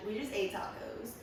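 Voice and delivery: usual speaking voice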